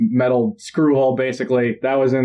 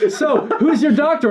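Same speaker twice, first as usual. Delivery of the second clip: laughing impression; 12 semitones higher than usual. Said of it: "And then he's like".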